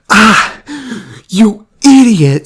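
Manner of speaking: high, loud mock yell of a frustrated kid; yelling at the ball in frustration, high and loud; imitated angry yell. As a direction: shout it out like a kid who just got hit by the ball. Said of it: "hits him in the face and goes".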